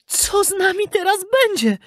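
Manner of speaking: very high, fretful puppet voice; worried puppet. A narrator's reading. Puppet turning into a donkey, worried. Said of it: "martwił się Pinokio".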